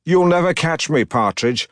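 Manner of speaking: put-on goading in a mid-Atlantic accent. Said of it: "his ridiculous mid-Atlantic accent".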